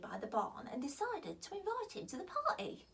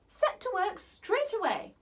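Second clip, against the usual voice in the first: high, bright order from a queen; a queen briskly giving orders; commanding queen; 8 semitones higher, 6 dB louder than usual. Acted. Ivy gives an order.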